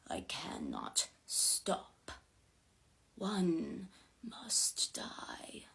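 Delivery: very slow, hushed, whispery spirit; eerie, drawn-out soft menace; possessed voice. A narrator's reading. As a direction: speak as a spirit through a stolen body, word by word. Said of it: "Something about that voice".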